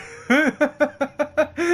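loud, high burst of laughter; high cackling laugh. Real, not acted. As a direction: crack up in a long high cackle. Laughs at breaking own neck.

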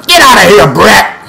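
high, loud angry order; exasperated shout. Real, not acted. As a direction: yell to chase him off. Driving off opponent in game.

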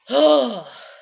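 heavy, loud sigh; big exasperated exhale. Real, not acted. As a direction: let out a loud, fed-up sigh. Exasperated venting.

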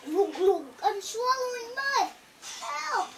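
high-pitched pleading; acted frantic begging, high up; begging witch. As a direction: plead as if sinking in mud. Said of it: "she begged".

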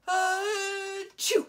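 a huge high explosive sneeze; loud sneeze. A narrator's reading. Dragon Roxy sneezing a ball of flame, huge sneeze. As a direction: sneeze it out with a blast. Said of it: "Roxy sneezed".

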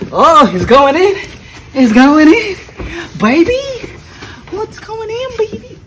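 squealing high and loud; thrilled the box fits; excited. Real, not acted.

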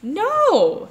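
a loud, high shout of refusal; yelled no. Acted. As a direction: yell it like a furious little girl. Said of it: "yelled Lulu".

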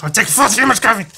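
high, fast, loud outburst; mock-frantic shout. Host confessing he is wired on caffeine, frantic shout. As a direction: blurt it out, over-caffeinated.

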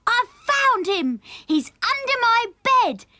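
high, loud, slow drawn-out yell; excited shout. A narrator's reading. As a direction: shout the discovery for the whole house to hear. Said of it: "he shouted".

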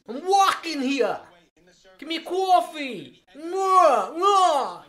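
loud, high hammed-up New York accent; loud mock New York accent, kisses and all. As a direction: do a brash New York cabbie bellowing. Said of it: "same accent as them".

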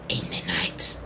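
whisper. Pretend. Spooky movie quote in game chat.

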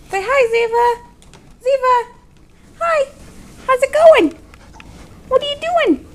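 high loud gushing at the dog; excited pet voice. Real, not acted. Mother greeting the dog Ziva, high excited pet talk.

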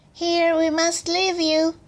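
high little creature voice; butterflies. A narrator's reading. Butterflies leaving the girl, high little voice. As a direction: say it pitched high. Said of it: "said the butterflies".

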